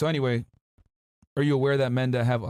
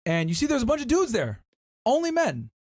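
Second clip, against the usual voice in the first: raised, higher pitch for the twist; dramatic reveal; 10 semitones higher than usual. A narrator's reading. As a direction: build up the surprise.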